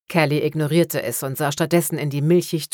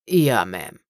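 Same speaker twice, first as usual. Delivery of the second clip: put-on old man's voice; 7 semitones lower than usual. A narrator's reading. Old corpse answers detective.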